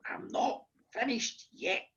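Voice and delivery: slow, soft, deliberate granny; quietly refuse, spacing the words out; stern granny, hushed. Retold or imitated. Granny silences protesting children, slow hushed voice. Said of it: "My granny said".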